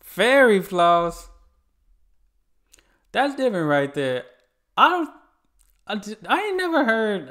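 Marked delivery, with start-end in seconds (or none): 0.0-1.3 loud, high, drawn-out disbelief; astonished. Real, not acted. Viewer reacting to unfamiliar Aussie slang, loud astonishment. 4.8-7.3 high-pitched, loud, stumbling disbelief; baffled. Real, not acted. Viewer who never heard the slang, high-pitched disbelief.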